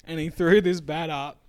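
ordinary talk, nothing marked

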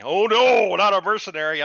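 high, loud faked gasp of horror; mocking characters' horror, high and loud; mock shock. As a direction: ham up fake outrage.

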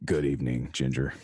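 a hint of a deep late-night host voice; greeting Ginger as a deep-voiced podcast host; deep radio host. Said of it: "talk really deep".

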